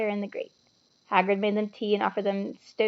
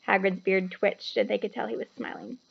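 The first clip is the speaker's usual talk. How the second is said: usual speaking voice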